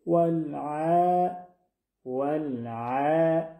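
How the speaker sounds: ولع chanted slowly with a soft throat ayn and thin vowel; slow, correct tajweed ولع. Shown as a demonstration. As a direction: stretch ولع gently from mid-throat, vowel kept light. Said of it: "باریک".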